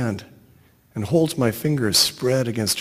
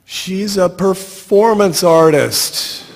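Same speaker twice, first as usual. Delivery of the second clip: yelling; 6 semitones higher, 3 dB louder, slower than usual. Retold or imitated. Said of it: "I'm yelling out the bus window".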